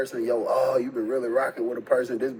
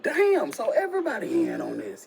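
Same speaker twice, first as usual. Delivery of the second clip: stunned realization; 9 semitones higher than usual. Retold or imitated. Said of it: "I'm like".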